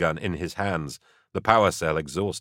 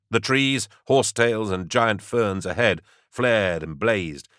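normal talking voice

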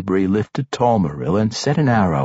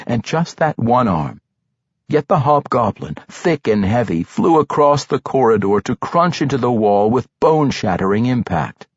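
ordinary delivery, nothing marked

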